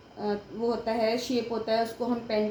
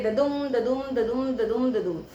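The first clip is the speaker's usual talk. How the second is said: ददुम five times in a steady running beat; iambic rhythm demo. Shown as a demonstration. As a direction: chant ददुम evenly, short द into longer दुम. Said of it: "हमारा बनता है इयम्बिक pentameter". (ददुम की लगातार लयबद्ध रट)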